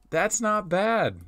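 slow, drawn-out nod of approval; slow approving. Real, not acted. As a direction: savor it slowly. Repeats verdict, first squeaky high.

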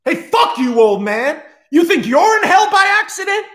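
put-on high, loud screaming rant at the old man; furious yelling. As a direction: tear into him at full volume. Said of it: "he goes".

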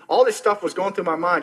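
ordinary delivery

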